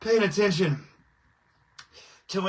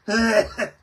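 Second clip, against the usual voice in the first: hacking cough; 3 semitones higher, 5 dB louder than usual. Real, not acted. Coughing after smoking hit.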